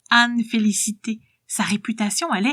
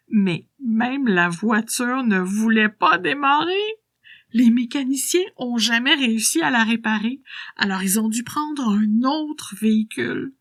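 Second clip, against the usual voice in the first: put-on spry old woman voice; 3 semitones higher than usual. Old woman tells her secret.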